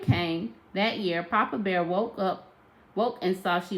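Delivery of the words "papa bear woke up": usual speaking voice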